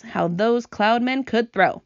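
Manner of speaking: plain reading voice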